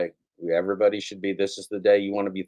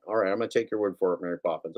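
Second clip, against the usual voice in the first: ordinary talk, nothing marked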